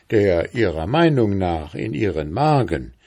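ordinary delivery

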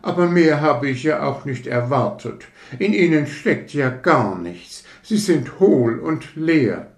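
a lightly voiced conceited rocket, touchy and proud; hint of a fussy aristocrat taking offence; vain rocket. Rocket lectures the fireworks about itself, mildly haughty.

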